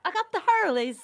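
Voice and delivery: loud, high mock Irish; hammed-up Irish pub-goer; Irish accent. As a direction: put on a playful Irish lilt. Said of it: "that was a good accent".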